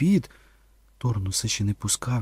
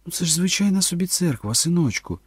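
choked, higher-pitched attempt to reassure; strained; 7 semitones higher than usual. A narrator's reading. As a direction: force calm through a tight throat. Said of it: "здавлено промовила Кетрін". (здавлено)

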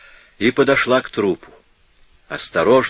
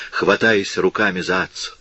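plain reading voice

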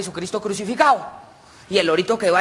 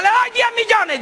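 high-pitched call from a crowd; shouted bid. Acted. Man in crowd outbids the others, calling out. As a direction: call out a higher offer eagerly. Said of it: "Gritó un man".

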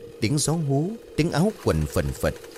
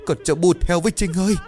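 grief-stricken wailing; 9 semitones higher than usual. Acted. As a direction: cry out the daughter's name in despair. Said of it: "Miệng của bà lắp bắp gọi tên của con gái". (mẹ gào khóc gọi con)

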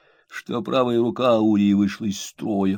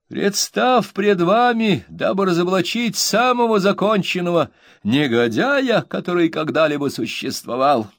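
pompous reading; 9 semitones higher, 3 dB louder than usual. A narrator's reading. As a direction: savour every grand word. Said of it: "выразительностью его чтения и восхищением самим собой".

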